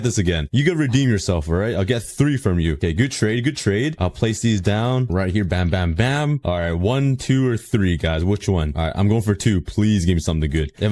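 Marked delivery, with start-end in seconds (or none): none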